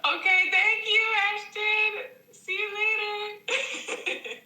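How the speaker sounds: high, hammed-up bad British accent; terrible British accent. Aunt answering her British nephew Ashton, in a high mock British accent. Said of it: "gonna do a terrible British accent".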